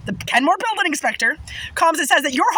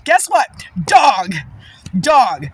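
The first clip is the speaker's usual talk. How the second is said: louder, spitting out each word; loud scornful punchline; 4 semitones lower, 5 dB louder, much slower than usual. Real, not acted. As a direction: call him a dog with contempt. Angry punchline about neighbor.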